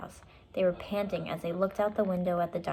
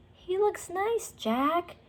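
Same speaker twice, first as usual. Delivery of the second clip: sweet; 11 semitones higher, much slower than usual. Acted. Annie charmed by dinosaur.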